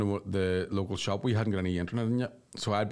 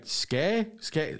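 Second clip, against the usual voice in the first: confused repeat; 6 semitones higher than usual. Retold or imitated. Confused repetition.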